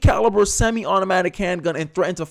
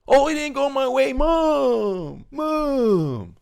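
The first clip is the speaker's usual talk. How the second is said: put-on high, whiny bratty kid calling Mom; mock crying; 9 semitones higher, much slower than usual. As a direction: wail it high with long, drawn-out vowels. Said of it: "I'm tired of all this crying".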